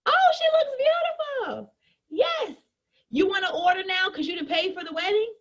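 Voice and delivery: squealing over wedding photos; gushing. Acted. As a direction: rave about the photos.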